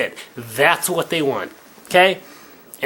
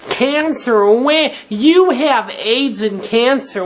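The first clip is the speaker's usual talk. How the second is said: mocking heckler; 8 semitones higher, slower than usual. Mocking the insults he gets.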